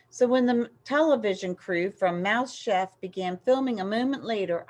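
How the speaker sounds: ordinary delivery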